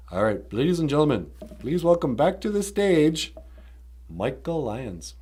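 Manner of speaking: stage announcer. Pretend. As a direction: welcome the guest like an emcee.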